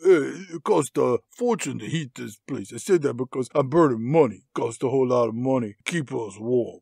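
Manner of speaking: low, slurred Stallone impression; low mock action-hero voice; Stallone quip. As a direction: mumble a lame joke down low as Stallone. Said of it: "he quips".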